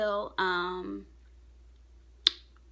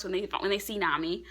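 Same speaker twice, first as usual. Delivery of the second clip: ordinary talk, nothing marked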